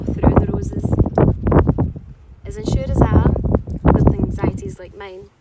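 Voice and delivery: loud, high, urgent outpouring; impassioned climax. Acted. An actor scared for you to know the real me, impassioned. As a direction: pour it out at full voice, pitched high.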